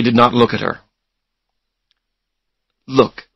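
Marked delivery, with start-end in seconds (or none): none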